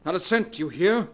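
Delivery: husband shouting at his leaving wife; angry threat. Acted. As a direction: threaten her with rising anger.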